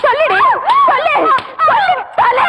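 bully her into answering; angry interrogation. Acted. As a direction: stepmother demands the truth from the girl. Stepmother interrogates girl.

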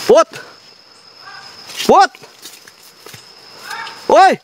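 very high, insistent call. Real, not acted. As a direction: call far off to locate someone. Calling out to find friend.

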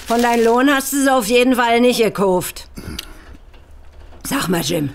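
ordinary talk, nothing marked

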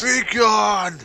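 hammed-up heroic shout; theatrical warrior yell; mock battle cry. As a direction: echo the knight's cry with gusto.

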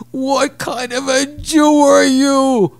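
high, loud, slow outraged yell; screaming. Retold or imitated. Walter screams at the young guest over his coldness. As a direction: scream it like a betrayed elder. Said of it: "starts screaming".

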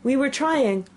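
high-pitched, pleading servant; humble. A narrator's reading. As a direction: start a meek excuse, left hanging. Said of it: "in a very humble tone".

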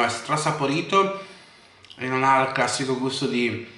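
talking with food in mouth; eating while speaking; chewing. Real, not acted. Seen 2: sandwich held at mouth, jaw chewing between bites throughout.

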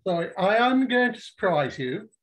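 ordinary talk, nothing marked

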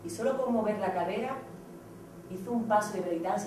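ordinary delivery

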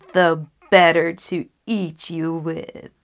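loud, slow wolf growl of a line; menacing wolf. Acted. Wolf to the girl just before he springs, loud and slow. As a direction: lunge with the words, a hungry wolf.

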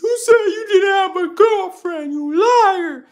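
high, shouted mock accusation; mocking commenter. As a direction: squeal it like the nagging commenter. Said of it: "the one that commented".

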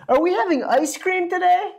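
put-on high eager children asking; children begging for a treat; excited kids' voices. A narrator's reading. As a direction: ask it like hopeful kids. Kids ask the teacher.

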